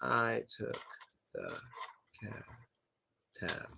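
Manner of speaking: very soft, slow cab tab; almost whispered example; example sentence. Shown as a demonstration. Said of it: "I'll say an example sentence".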